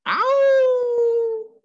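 a high, loud drawn-out dog howl. Acted. Winn-Dixie howling from outside the church, high and loud. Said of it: "said Winn-Dixie".

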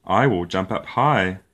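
ordinary delivery

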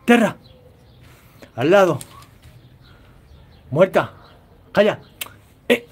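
high, loud commands barked at the dog; sharp dog commands. Real, not acted. Trainer ordering the dog to stay and play dead, loud and high. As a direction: snap each command at the dog to make her obey.

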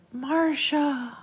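high, drawn-out dreamlike call; child calling from afar. A narrator's reading. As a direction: call the name out long and high. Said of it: "while calling out".

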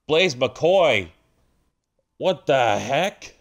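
high-pitched, stunned ringside outburst; shocked commentary. Real, not acted. Commentator reacting to Blaze McCoy turning on his partner, shocked. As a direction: call it in real disbelief, voice jumping up.

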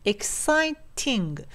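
"exciting" very slow and split, with a crisp t in "ting"; clear T. Shown as a demonstration. Teacher points out the T, slow "exciting" with clear t. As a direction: stretch out "exciting", sounding the T clearly. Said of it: "このTですね".

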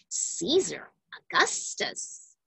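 ordinary delivery

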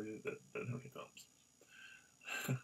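whisper. Acted. Whispered joke aside.

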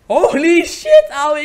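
slow, loud, drawn-out amazement; stunned loud exclamation. Real, not acted. Gamer shocked by what happened on screen, stretched loud cry. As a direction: let it burst out, stretched and loud.

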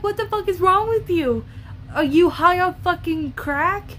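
high, loud, disbelieving; outraged yell. Retold or imitated. Reacting to Will Smith, shouting in disbelief. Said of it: "I'm like".

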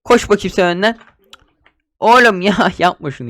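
ordinary delivery

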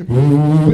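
loud startled ooh; hammed-up loud ooh; loud mock ooh. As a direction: blurt a big comic ooh. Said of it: "he was like".

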